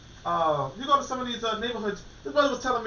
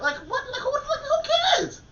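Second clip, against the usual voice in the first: incredulous laughing. Real, not acted. Scoffing at listeners' Malcolm X chatter, high incredulous laugh. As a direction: pitch jumps far higher in laughing disbelief. Mocking Malcolm X book talk.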